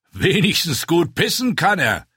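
a rider scoffing at the dwarf; mocking rider. A narrator's reading. Rider mocks the pissing dwarf, scoffing voice.

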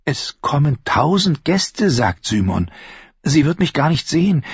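junge Frau, höher gesprochen, neugierig; Shay. Acted. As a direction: fragt ihn leise drängend aus. Shay plant bittend. (Shay, junge Frau, höhere Stimme)

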